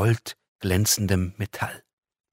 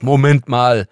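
a loud, higher angry outburst; angry call; 4 semitones higher, 12 dB louder, slower than usual. Acted. Girl jumping up at the angel, loud and angry. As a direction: cut her off in a flash of temper. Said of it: "rief sie wütend".

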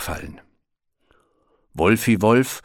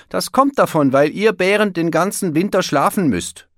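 know-it-all; 11 semitones higher, 5 dB louder than usual. A narrator's reading. Wolf teasing the bear.

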